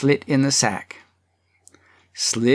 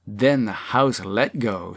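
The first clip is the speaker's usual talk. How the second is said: ordinary delivery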